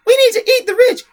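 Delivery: very high, loud squeal; mocking childish politician. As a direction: squeal it like a slogan-shouting child. Mocking a politician she calls childish.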